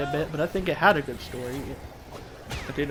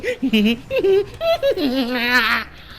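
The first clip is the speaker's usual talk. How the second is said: villain laugh; 8 semitones higher, 3 dB louder than usual. Retold or imitated. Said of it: "messed up the laugh".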